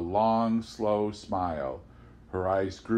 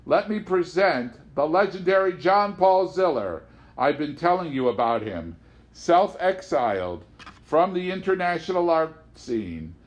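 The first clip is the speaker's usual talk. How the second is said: higher and louder, a showman presenting a star; booming ringmaster introduction; 5 semitones higher, 4 dB louder than usual. A narrator's reading. Ringmaster Nearly Normal proudly presenting Ziller to Amanda, booming showman voice. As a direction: announce him like the big act of the circus.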